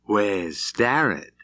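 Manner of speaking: slow, insolent drawl; lazy, taunting challenge; a gunman lazily taunting across the saloon. A narrator's reading. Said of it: "His voice was lazy and insolent".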